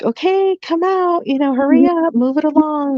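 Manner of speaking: high, hurried teacher voice; impatient teachers. Retold or imitated. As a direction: rush the parents along like a fed-up school teacher. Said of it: "talking like that".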